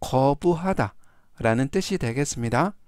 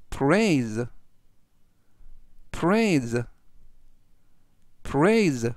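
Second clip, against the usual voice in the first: measured word-by-word reading for repetition; slow model reading; much slower than usual. Shown as a demonstration. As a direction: give each repeat room for learners to follow. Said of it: "천천히 세 번씩 읽어드리고요". (따라 하도록 천천히 읽기)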